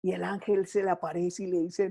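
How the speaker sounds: ordinary talk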